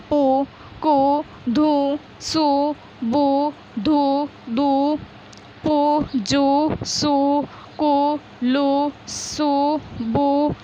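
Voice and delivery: each syllable drawn out slowly and clearly; slow syllable drill. Shown as a demonstration. Teacher to pupils, drawing out each syllable slowly. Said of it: "इस प्रकार पहले बोल बोल के प्रयास करना है".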